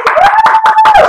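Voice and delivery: high whooping cheer; loud excited woo. Real, not acted. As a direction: whoop to pump up the class. Trainer cheering the class.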